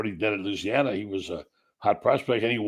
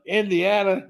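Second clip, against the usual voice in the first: Indiana? much higher, in disbelief; second Indiana, pitched up in mock disbelief; incredulous repeat; 9 semitones higher than usual. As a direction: repeat Indiana? with rising astonishment. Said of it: "I'm thinking".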